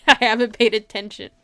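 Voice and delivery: ordinary talk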